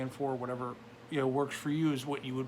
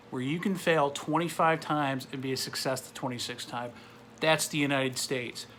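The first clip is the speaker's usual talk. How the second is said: ordinary talk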